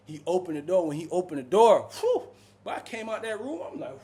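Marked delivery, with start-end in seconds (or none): 2.0-2.7 high, breathy relief; relieved whew. Retold or imitated. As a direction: let out a big whew. Relief after stench.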